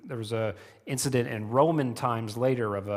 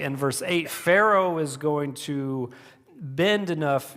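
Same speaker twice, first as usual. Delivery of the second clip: ordinary talk, nothing marked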